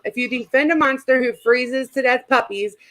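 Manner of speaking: ordinary talk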